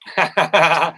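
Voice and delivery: loud burst of laughter; loud, quick cackling chuckle. Real, not acted. Laughs at the animals' antics.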